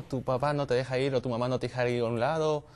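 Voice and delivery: ordinary talk, nothing marked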